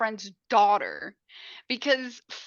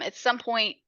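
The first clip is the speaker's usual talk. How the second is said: normal talking voice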